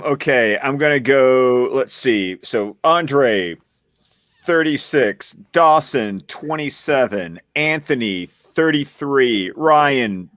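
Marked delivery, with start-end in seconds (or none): none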